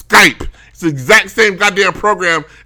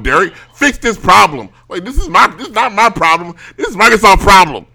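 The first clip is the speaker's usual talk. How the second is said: louder, punchy indignant rant; exasperated complaint; 5 dB louder than usual. Acted. Hector complains that Billy can't be logged out, exasperated. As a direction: complain loudly, hitting the key words. Acting out an irate colleague's complaint.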